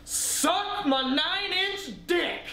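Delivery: drawn-out, high mock yell, very slow; mock scream. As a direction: stretch it out high like a crazed stranger yelling. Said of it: "he just screamed the most absurd thing".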